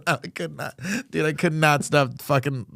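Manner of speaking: laughing. Real, not acted. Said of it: "I was dying laughing".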